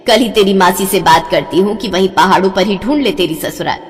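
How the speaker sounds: usual speaking voice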